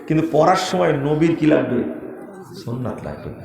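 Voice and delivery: usual speaking voice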